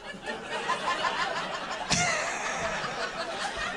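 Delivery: high-pitched breathy cackling laugh; high cackle. Retold or imitated. Acting brother's reaction.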